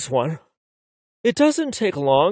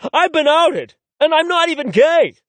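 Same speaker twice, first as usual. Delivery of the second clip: much higher, louder outraged rant; 11 semitones higher, 6 dB louder than usual. Acted. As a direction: rant at full pitch, offended. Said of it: "let Heath rant on".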